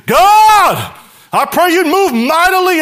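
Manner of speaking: loud, high, showy shout of a prayer. Retold or imitated. Preacher mocking a showy prayer with a loud shout. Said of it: "gets up here and say".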